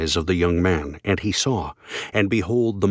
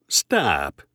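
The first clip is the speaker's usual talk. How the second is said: ordinary talk, nothing marked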